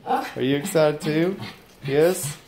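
ordinary talk

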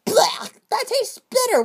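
high grossed-out yuck; disgusted. Acted. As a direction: spit out the taste in disgust. Character tastes bitter apple.

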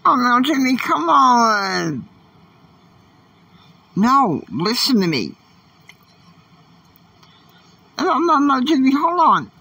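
second self interrupting the host, loud and high; pleading other self. Pretend. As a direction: call out to stop Jimmy. Arguing with himself, calling out.

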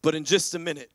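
normal talking voice

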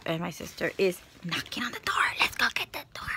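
hushed whisper so the sister won't hear; whispering as sister knocks. Real, not acted. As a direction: whisper quickly at the door.

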